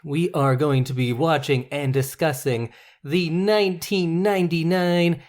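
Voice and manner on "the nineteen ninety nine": infomercial voice. Acted. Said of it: "infomercial voice".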